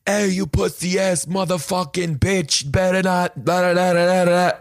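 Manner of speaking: high mock yell with a Providence twang; retold angry guy yelling at a jaywalker; imitating a screaming local. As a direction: lightly act out the street screamer. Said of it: "this guy screamed at me".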